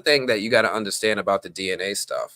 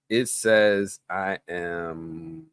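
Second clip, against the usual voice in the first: ordinary talk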